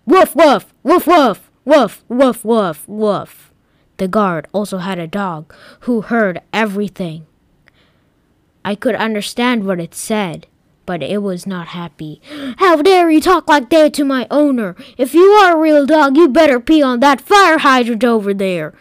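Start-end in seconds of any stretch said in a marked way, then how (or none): 0.1-3.5 barking dog that winds down; dog barks. Acted. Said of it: "All that came out was". 12.6-18.7 much higher and louder, a snappy dog; a guard dog scolding; indignant dog defending its owner; angry dog voice. Acted. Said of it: "said the dog".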